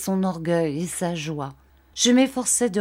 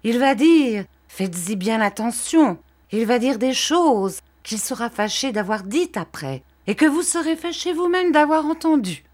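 high, urgent warning, climbing in pitch; crying out; 6 semitones higher, 3 dB louder than usual. A narrator's reading. Uriah warning Copperfield of what Wickfield will say, crying out. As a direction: push it higher as the warning builds. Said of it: "s'écria Uriah en tournant vers moi".

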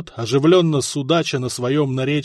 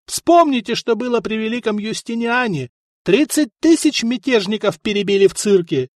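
raised threatening voice; 6 semitones higher than usual. Acted. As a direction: call out a grim reminder. Orator threatens the crowd.

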